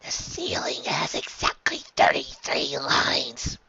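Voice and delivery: slow, loopy whisper; drugged conspiratorial whisper. Acted. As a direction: whisper it like a secret, dreamily. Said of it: "He whispered".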